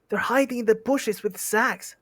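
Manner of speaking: high, urgent hobbit call; high-voiced alarm; Bilbo's warning cry. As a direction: pitch it up and warn them. Bilbo warns Thorin about trolls.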